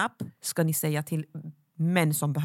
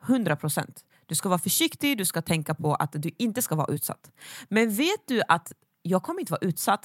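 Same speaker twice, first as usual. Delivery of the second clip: ordinary talk, nothing marked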